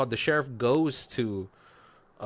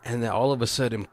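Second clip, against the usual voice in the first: ordinary talk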